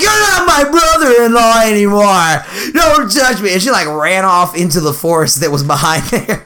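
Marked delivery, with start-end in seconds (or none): 0.0-3.5 high, drawn-out mock scream; imitating a wailing, outraged teen; retold yelling. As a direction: mimic an upset girl yelling. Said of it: "so she just yelled at me".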